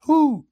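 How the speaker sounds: high, loud imitated owl hoot. Camper imitating the owl heard by the fire, high loud hoot. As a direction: hoot like an owl calling from the woods. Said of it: "and we heard this".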